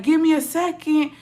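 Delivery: imitating him in a high voice; high-pitched mock reply. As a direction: stall the police in a put-on high voice. Acting out Gein stalling the police.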